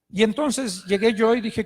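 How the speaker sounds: normal talking voice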